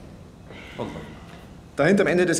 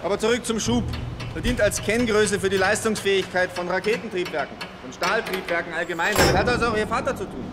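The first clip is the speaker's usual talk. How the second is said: raised voice; 7 semitones higher than usual. Acted. Speaking over factory noise.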